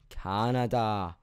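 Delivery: Canada stretched slowly and firmly; slow emphatic correction. Shown as a demonstration. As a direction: drag out Canada to hammer it home. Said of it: "Not America".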